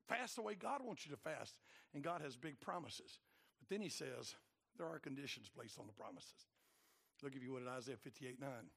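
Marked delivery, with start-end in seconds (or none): none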